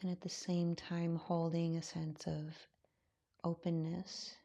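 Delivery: calm, unhurried voice with long pauses; guided meditation. Acted. Yoga teacher settling students into their breath, guided meditation. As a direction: ease them gently into stillness, softening as you go.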